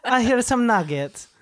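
high put-on mom voice with an accent; mom impression. Son imitating his mother bringing nuggets, high put-on voice. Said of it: "It was like".

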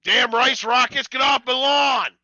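high, loud, slow mock yell; hammed-up old man yell at passing cars; grumpy old man. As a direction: shout it like a cranky old man on his porch. Mock old man yelling at car.

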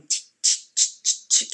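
voiceless sharp tch clicks; crisp separate cracking noises; imitated cracking glass. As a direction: imitate microfractures spreading. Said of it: "microfractures all through the window going".

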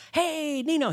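high, cheery greeting impression; really cheery. Karate master's son phones a student's dad, cheery. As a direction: sing out a chirpy hello. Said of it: "He's, like, really cheery guy".

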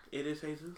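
"Jesus" softly said as hay-SOOS; Spanish pronunciation. Shown as a demonstration. Co-host echoes the cult's name, soft Spanish hay-SOOS. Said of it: "Jesus' name is not Jesus".

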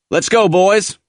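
high-pitched NCO calling out; rallying call. A narrator's reading. NCO to his men at the tractor, rallying. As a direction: get the men moving.

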